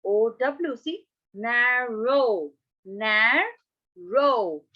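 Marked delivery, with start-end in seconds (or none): 1.3-4.6 "narrow" slowly as na, pause, row; broken into syllables. Shown as a demonstration. Teacher counting syllables, "narrow" split in two. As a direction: pull "narrow" apart into two long beats. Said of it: "So two syllables".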